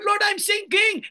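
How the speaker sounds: high, slow, loud shout to the Lord; desperate cry. Acted. Preacher modeling a cry to the Lord for the congregation. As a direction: cry out like someone going under. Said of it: "Dapat yan ang iyak kay Lord".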